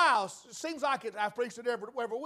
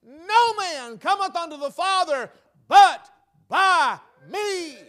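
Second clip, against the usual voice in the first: loud declaimed King James verse; loud preacher quoting scripture; ringing scripture quote; 3 semitones higher, 9 dB louder than usual. Retold or imitated. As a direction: proclaim "No man cometh unto the Father" at full voice. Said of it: "he went on to say this".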